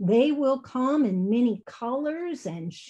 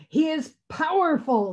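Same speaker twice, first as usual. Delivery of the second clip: higher-pitched growly bear voice; growled; 7 semitones higher, 3 dB louder than usual. A narrator's reading. Bear answers the robin in the argument, forceful growl. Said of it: "growled the bear".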